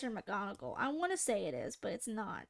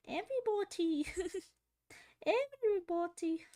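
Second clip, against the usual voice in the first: posh British woman; 6 semitones higher than usual. Retold or imitated. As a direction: stretch it out like a prim British lady. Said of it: "Who is it that I sound like now?".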